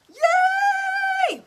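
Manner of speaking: high, loud, held yay; excited yay. Real, not acted. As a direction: shout it with pure joy. Cheering on livestream.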